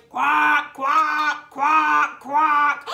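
big, loud, low duck quacks. Shown as a demonstration. Teacher showing children a loud voice, loud quacking. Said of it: "Can you use a loud voice?".

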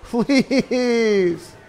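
high, pleading cries; rising desperate begging. Real, not acted. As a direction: beg the card to do something. Begging card to shoot.

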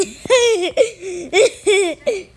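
distant mocking laugh. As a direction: laugh from off to the side. Another figure laughs.

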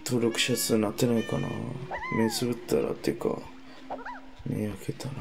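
very soft drowsy mumble; fading off to sleep; sleepy. Real, not acted. Falling asleep drunk, late. (眠そうな小声)

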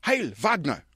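lightly voiced mock German salute; a hint of a pompous director barking; Lars von Trier. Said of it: "Director Lars von Trier".

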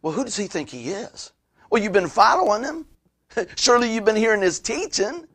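high-pitched mock disbelief; sceptical religious folks. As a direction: mimic sniping doubters, lifting the pitch on each jab. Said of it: "religious folks start looking around".

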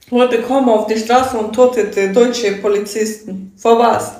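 ordinary delivery

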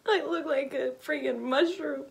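high, loud laughing wail; half laughing, half crying. Real, not acted. Laugh-crying at her look.

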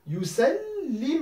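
yusallimu slowly, double l held; slow model word. Shown as a demonstration. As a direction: draw out yusallimu for the class. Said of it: "j'ai une écriture possible".

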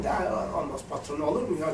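soft, humble refusal as the boss; mock polite refusal. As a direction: quietly turn down the offer like a shy boss. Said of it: "Lan dedim, bir parça bir şey yapayım". (alçak sesle mahcup reddediş)

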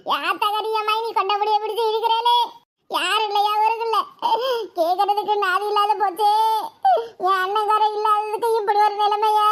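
long-drawn mock wailing lament; hammed-up sobbing on a monotone; theatrical crying. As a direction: wail it like crocodile tears. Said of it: "நீ நிஜமா தான் அழுகுறியா?".

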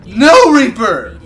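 loud, rising shouts of dismay; real gamer's outcry, very loud; panicked protest. Real, not acted. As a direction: yell it as the attack lands. Attacked by Reaper in game.

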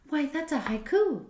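high, loud delighted exclamation; child's excited cry of discovery. A narrator's reading. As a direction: burst out with happy recognition. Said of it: "cried Nona, delighted".

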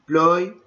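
single out the syllable "ploy"; syllable isolated. Shown as a demonstration. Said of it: "Ploy, O and Y".

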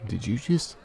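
high, loud, startled; startled outburst. Acted. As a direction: blurt it out, caught off guard. Reacts to sudden kiss.

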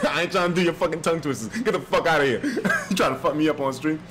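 talking through real laughter; amused, giggly complaining; laughing protest. Real, not acted. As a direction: laugh off the challenge while griping. Laughs off the tongue twister.

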